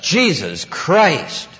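drawn-out high, loud exclamation; retold shout of exasperation; imitated yell. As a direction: yell it out like an oath, stretching both words. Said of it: "a guy yelled out".

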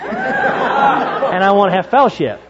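laughing mock plea.

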